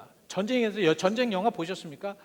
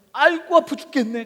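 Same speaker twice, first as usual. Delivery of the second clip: acted cry of pain, higher and louder; 5 semitones higher, 8 dB louder than usual. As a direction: groan loudly as if badly hurting. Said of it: "크게 얘기하잖아요". (아프다고 크게 외치는 흉내)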